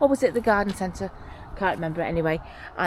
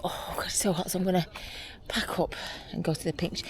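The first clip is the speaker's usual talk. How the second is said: whisper; 8 dB quieter than usual. Real, not acted. Whispering about the heat.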